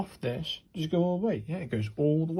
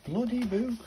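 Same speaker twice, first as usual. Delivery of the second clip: annoyed; 10 semitones higher than usual. Real, not acted. Book blocks the camera view.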